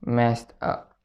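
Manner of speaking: ordinary talk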